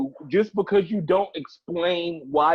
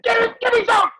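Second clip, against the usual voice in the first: high-pitched wailing shout; hammed-up begging at a yell; mock desperate pleading; 13 semitones higher, 5 dB louder than usual. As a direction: cry out in exaggerated desperation. Comic pleading demand for a story.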